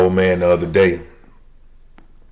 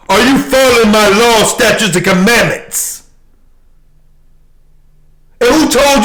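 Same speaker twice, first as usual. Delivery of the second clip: much higher, louder preaching voice; fiery preaching; 10 semitones higher, 3 dB louder than usual. Acted. Preacher scolding the congregation, fiery raised preaching. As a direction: rebuke the flock from the pulpit. Preaching at full force, rising.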